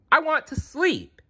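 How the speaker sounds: put-on very high-pitched childlike whine; yelled complaint. A narrator's reading. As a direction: wail it like a grumpy little one. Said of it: "yelled Littlefoot".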